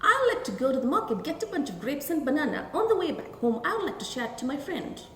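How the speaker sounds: fast, words run together; quick contracted speech. Shown as a demonstration. Teacher repeating the contracted version for children, fast. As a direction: rush it casually. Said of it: "repeat the statement once again".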